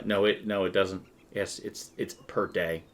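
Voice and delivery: usual speaking voice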